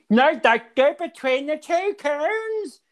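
high, slow, mangled Northern Irish order; strained Belfast twang, drawn out and high; mock Northern Ireland accent. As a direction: imitate the steward's bad Northern Irish accent. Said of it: "speaking in a Northern Ireland accent".

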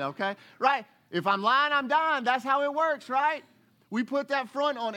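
high, loud, all-out preaching; preaching hard. Real, not acted. Preacher calling out the congregation, loud and high. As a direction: call them out at full force. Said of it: "preacher ramping up".

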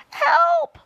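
very high, loud scream; crying for help. A narrator's reading. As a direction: shriek for rescue. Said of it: "cried the centipede".

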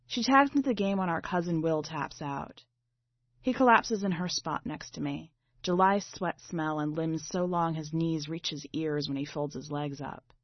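ordinary delivery

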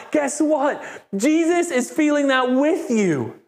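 high, elated proclamation; joyful excitement. Acted. Preacher telling a rejoicing listener Jesus feels it too, elated. Mirrors the overjoyed person's mood.

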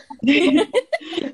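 helpless nervous giggling fit; can't stop laughing from nerves; hysterical laugh. Real, not acted. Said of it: "Duda tendo um ataque de riso e de nervoso".

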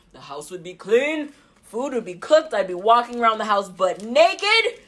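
control freak alter ego. Pretend. Control-freak alter ego telling her family to listen, bossy and laughing. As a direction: boss everyone around half-laughing. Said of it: "I have this alter ego".

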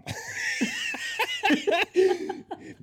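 hohes, lautes Lachen; lautes Auflachen. Real, not acted. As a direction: platzt vor Lachen heraus. Lacht laut auf.

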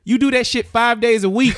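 loud emphatic shout; heated family shouting. Real, not acted. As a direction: yell it to drive it home. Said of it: "Stop yelling at me".

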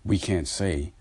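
ordinary talk

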